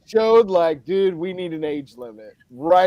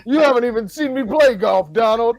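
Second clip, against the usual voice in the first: higher and louder mock candidate jab; mock debate jab; 7 semitones higher, 7 dB louder than usual. Acting out one candidate taunting Donald, higher and louder. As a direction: needle your rival in a raised mocking voice.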